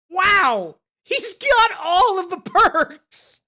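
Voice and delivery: loud, high, drawn-out disbelief; gleeful amazement. Real, not acted. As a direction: crow it slowly, on the edge of laughter. Amazed at character's drinking stat.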